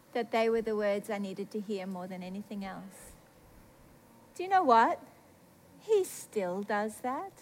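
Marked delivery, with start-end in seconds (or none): 5.8-7.3 low, soft, very slow; quiet wonder. Real, not acted. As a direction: let it out slowly and softly. Reflective first take, then exclaimed.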